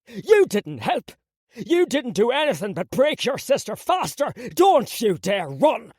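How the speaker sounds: furious father. Acted. Father blaming son furiously.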